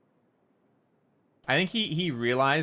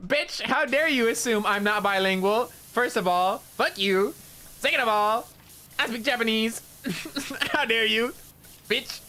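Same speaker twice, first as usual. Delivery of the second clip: high, hammed-up mock outrage; jokingly offended; 11 semitones higher than usual. Imagined rant at the Canadian guy, high mock outrage. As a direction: squeal it like a pretend diva tantrum. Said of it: "jokingly offended".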